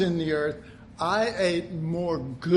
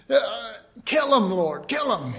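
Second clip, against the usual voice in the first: mock vengeful prayer; 4 semitones higher, 3 dB louder than usual.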